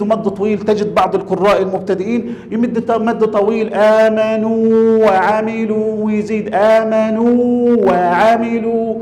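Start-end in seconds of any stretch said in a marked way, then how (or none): 3.7-8.9 آمنوا وعملوا with the long vowels stretched far past two beats; overlong madd. Shown as a demonstration. Teacher showing students a beginner's error in آمنوا وعملوا: vowels dragged too long. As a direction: drag out the aa and uu of آمنوا وعملوا slowly, like a beginner reciter. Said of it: "هذا خطأ".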